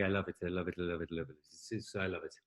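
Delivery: soft, low murmured repetition; imitated director's catchphrase. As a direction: mumble it deep and quietly, imitating an approving director.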